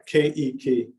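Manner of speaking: "Keiki" split into KAY-ee-kee; three syllables. Shown as a demonstration. Teaching that it's three syllables, "Keiki" as KAY-ee-kee. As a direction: sound out all three syllables of "Keiki", falling at the end. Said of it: "It's actually three syllables".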